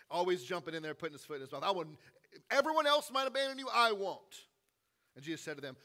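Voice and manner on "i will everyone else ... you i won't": fast, loud, high eager promise; eager boast. Retold or imitated. Peter blurting a promise to Jesus, loud and fast. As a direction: blurt it out like an overeager friend.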